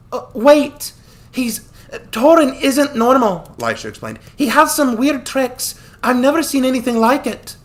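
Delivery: put-on a young woman worried about the strange enemy; urgent girl. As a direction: call after him to stop. Lisha's voice warning him.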